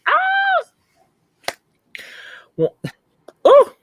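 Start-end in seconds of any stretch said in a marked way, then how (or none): 0.1-1.5 high shrieking gasp of shock; horrified gasp. Retold or imitated. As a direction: gasp like an appalled Italian. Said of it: "all the Italians were, like, gasping".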